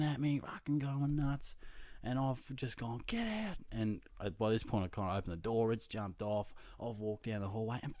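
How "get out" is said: high-pitched mock outrage; mock angry yell. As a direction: re-enact a fed-up order in a raised pitch. Retold ordering the cat out.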